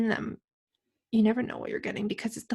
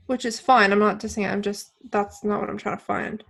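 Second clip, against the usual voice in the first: usual speaking voice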